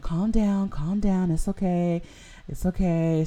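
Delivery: soothing nurse. Retold or imitated. Nurse calming the panicking driver, gently soothing. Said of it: "she was like".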